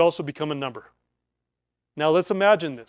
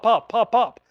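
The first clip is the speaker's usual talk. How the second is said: gunfire pops; 5 semitones higher, 6 dB louder, slower than usual. Retold or imitated. Imitating gunfire.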